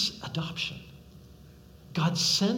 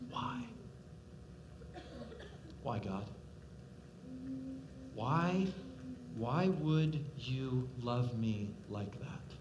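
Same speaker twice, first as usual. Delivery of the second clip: awed wonder; 6 semitones lower, 7 dB quieter, slower than usual. Pretend. Worshipful wondering question to God.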